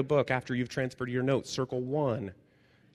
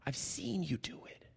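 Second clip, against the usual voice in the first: soft unvoiced whisper to a player; whispered coach encouragement; 4 semitones higher, 6 dB quieter than usual. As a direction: lean in and whisper reassurance. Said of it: "he says".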